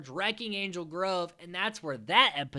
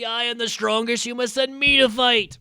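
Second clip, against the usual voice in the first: higher, louder pompous boast; boastful villain; 7 semitones higher, 7 dB louder than usual. Retold or imitated. Goldar boasting to Rita, loud hammed-up bravado. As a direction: puff up and proclaim. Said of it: "And he's like".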